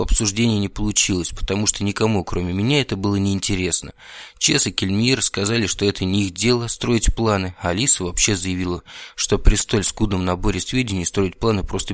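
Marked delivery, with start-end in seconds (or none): none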